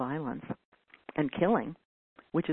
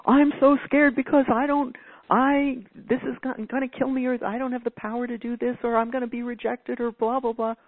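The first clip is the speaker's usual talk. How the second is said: higher, hammed-up fretting; mocking an anxious inner monologue, pitched higher; mock anxious whine; 7 semitones higher, 4 dB louder than usual. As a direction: whine out the scary story in mockery.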